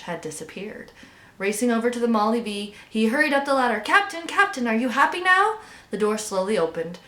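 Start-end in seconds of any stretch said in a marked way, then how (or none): 3.8-5.6 high, excited frog's question; eager calling out. A narrator's reading. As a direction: call up hopefully, pitch climbing to the end. Frog hurries up calling Captain.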